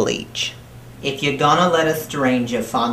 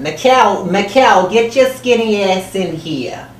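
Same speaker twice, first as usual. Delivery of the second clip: put-on loud, higher yell across the house in New Jersey accent; calling out; 8 semitones higher, 7 dB louder than usual. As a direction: holler for your son to come in. Calling son into the room.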